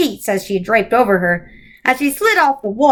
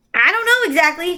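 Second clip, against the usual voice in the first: put-on small boy; 7 semitones higher than usual. A narrator's reading. Charles Wallace voiced.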